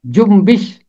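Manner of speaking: जुम्बिश said carefully, न sounding as म before ब; pronunciation of जुम्बिश. Shown as a demonstration. Teacher to class, showing how जुम्बिश is pronounced, careful and clear. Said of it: "इसका तलफ़ुज़ है जुम्बिश".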